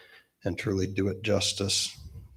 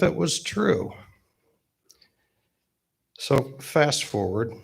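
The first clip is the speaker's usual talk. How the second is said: ordinary delivery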